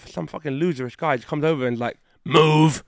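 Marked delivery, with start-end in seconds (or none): 2.2-2.8 curt command. Retold or imitated. Rude judge orders them away.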